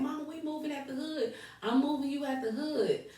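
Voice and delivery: high but soft mock scream; retold excited scream. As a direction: imitate an excited winner without shouting. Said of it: "jumping up and down screaming".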